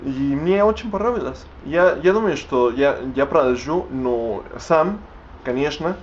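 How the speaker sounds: normal talking voice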